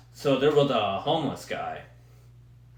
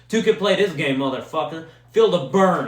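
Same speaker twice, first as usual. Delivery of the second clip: louder, raised-voice imagined comeback; lightly hammed-up taunt, louder; mock defiant; 3 semitones higher, 7 dB louder than usual. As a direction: throw it out as a mock challenge. Acts out imagined comeback to attacker.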